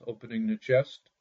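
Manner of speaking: plain reading voice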